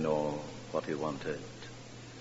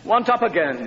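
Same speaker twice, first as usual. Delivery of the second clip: put-on much higher, louder whiny child demand; whining child; 13 semitones higher, 7 dB louder than usual. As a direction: play the toddler escalating his demand. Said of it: "he said".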